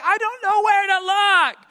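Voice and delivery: put-on high, loud kid's wail; mock childish whine, shouted; upset first-grader. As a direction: complain like an overwhelmed little boy. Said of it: "my first grade son cannot take this".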